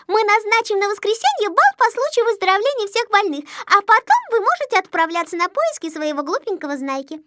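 put-on high girlish character voice, eagerly planning; Снежинка, a kindly girl. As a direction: announce the plan in a high little-girl voice. Снежинка speaks in the story. (высоким голоском малышки, воодушевлённо)

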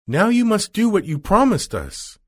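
high, insistent heroine; Dorothy demanding. Acted. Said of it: "Then Dorothy said again".

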